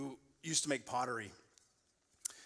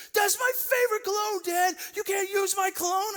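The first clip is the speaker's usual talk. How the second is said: much higher, louder mock teenage outburst; teen son yelling; 9 dB louder than usual. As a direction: play the outraged sixteen-year-old. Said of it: "He's like".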